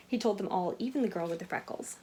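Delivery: usual speaking voice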